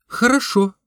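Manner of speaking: высоко, звонко, девичьим голосом; сыграй юную девушку, согласную на встречу; голос девушки. A narrator's reading. Девушка отвечает рассказчику. (девушка соглашается, высоким девичьим голосом)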